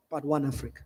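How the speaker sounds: normal talking voice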